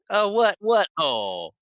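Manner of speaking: high, loud mock double take; imitated dismay. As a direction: act out a startled, dismayed reaction. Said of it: "his face falls in seconds".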